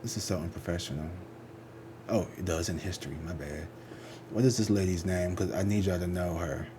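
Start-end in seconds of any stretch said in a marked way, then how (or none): none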